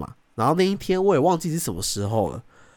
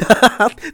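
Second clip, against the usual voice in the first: loud laugh; 10 semitones higher, 8 dB louder than usual. Real, not acted. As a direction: burst of laughter. Joking about absent co-host. (大笑)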